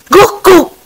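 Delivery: loud, high imitated bark; storyteller's spoken woof; dog barking. A narrator's reading. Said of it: "Anjing menyala keras".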